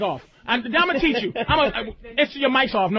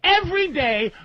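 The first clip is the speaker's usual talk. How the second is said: usual speaking voice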